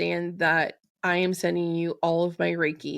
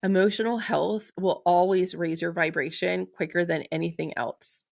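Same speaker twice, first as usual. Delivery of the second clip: usual speaking voice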